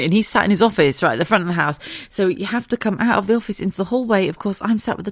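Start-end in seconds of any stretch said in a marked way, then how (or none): none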